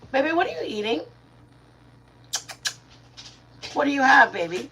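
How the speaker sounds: high, sweet voice for the dog. Real, not acted. Owner asks her dog what it's eating, high baby talk. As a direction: coo at the dog while it chews.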